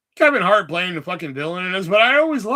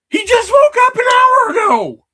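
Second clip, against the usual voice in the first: very high, loud shrieking yell; high squealing outburst; shrill yell; 12 dB louder than usual. Real, not acted. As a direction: screech it out at him. Sudden shrill outburst at viewer.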